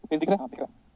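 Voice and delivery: soft, listless mumble that dies away; mock a gloomy student reading without any life; dejected mumble. Shown as a demonstration. Teacher mocks a gloomy student reading the book, listless mumble. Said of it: "देखो आज के बाद से मैं ऐसे पढ़ूंगा".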